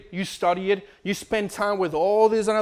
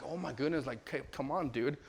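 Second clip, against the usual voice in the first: weary exasperation; 3 semitones lower, 12 dB quieter than usual. Retold or imitated. Weary parent at a tantrum.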